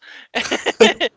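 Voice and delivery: high, loud burst of laughter. Real, not acted. Laughing after yelling at the walkers, loud laugh. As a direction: crack up at your own yell.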